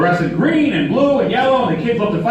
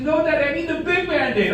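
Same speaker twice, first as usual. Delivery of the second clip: put-on higher childish whine; imitating a crying daughter; 6 semitones higher than usual. Daughter demanding the big Band-Aid from dad, higher mock whine. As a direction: play the little girl, mock tearful. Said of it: "She said".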